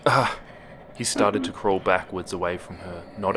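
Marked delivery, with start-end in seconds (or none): 0.1-0.4 short, higher, louder yelp; startled yelp. A narrator's reading. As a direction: let out a sudden yelp of fright. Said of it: "Toby yelped and fell back".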